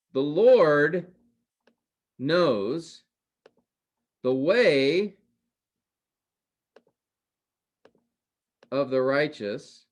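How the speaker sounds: slow, weighty verse reading with long pauses; slow emphatic reading. Shown as a demonstration. Teacher reading scripture to class, slow and emphatic. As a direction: lay out each phrase slowly with pauses.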